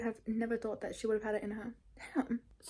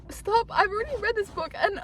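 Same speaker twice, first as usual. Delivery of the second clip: high and loud, rushed with delight; giddy squealing; 10 semitones higher, 6 dB louder than usual. Real, not acted. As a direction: gush like you can't contain it. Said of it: "making me so happy".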